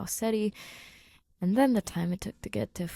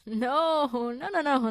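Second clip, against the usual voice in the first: sputtering denial; 6 semitones higher, slower than usual. Acted. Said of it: "Grace sputters".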